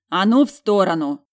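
loud, forceful command. A narrator's reading. Ordering her sister away from the door, forcefully. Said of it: "а сейчас кричать была готова".

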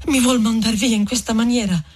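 high-pitched, hurt entreaty; pleading. A narrator's reading. Renzo begging the departing friar, high and pleading. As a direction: beg not to be sent away. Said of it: "andandogli dietro in atto supplichevole".

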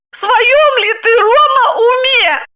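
very high, loud outraged cry; screaming. A narrator's reading. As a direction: shriek at your husband in outrage. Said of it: "закричала она". (пронзительный возмущённый крик)

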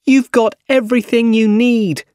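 high, loud angry-sounding shout. A narrator's reading. Captive MJ shouts at Doc Ock, shouting. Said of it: "she shouted at Doc Ock".

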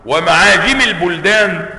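usual speaking voice

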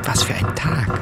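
seufzend geflüstert; flüstere es erschöpft. Acted. Innerer Monolog geflüstert. (geflüstert, erschöpft)

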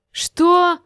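high, loud shouted question. A narrator's reading. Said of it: "закричал Фин". (высокий громкий выкрик)

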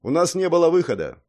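loud, high protest; defensive. A narrator's reading. Knight defends the monk to old man, defensive. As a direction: speak up firmly in defence. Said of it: "встал на защиту".

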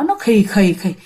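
slow raspy hissing breaths; eerie breath sounds in the dark; ghostly breathing. Retold or imitated. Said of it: "rất là rõ tiếng thở của người đó".

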